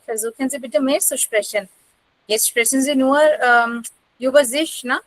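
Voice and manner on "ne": normal talking voice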